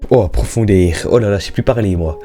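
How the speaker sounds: ordinary talk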